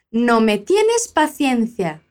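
slow, careful line for students to copy; slow teacher model. Shown as a demonstration. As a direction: say it slowly so they can repeat. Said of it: "a forma que o Carlos se expressa".

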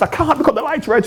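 a squeaky, panicked reply; high mock panic. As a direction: whine it like a scared driver. Jimmy answering in panic.

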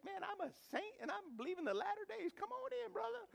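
very high, gushing mock greeting; eager welcome. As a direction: ham up the overjoyed host.

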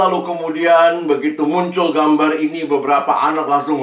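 normal talking voice

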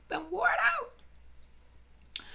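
very slow, high mock wail; exaggerated lament that the color is finished; drawn-out high whine. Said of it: "repeating complaint".

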